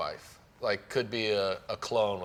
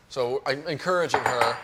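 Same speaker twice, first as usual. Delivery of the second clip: ordinary talk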